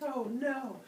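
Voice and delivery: high, hammed-up "oh no"; mock dismay. Zeus learns there is no cure, high mock dismay. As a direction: wail it in comic despair.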